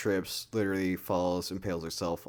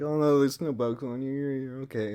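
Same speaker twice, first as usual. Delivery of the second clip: higher, lightly put-on reassurance; mock soothing friend; 6 semitones higher, 3 dB louder than usual. Friend tells bug girl she's fine, mock soothing higher voice. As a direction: coo at her like a dismissive friend.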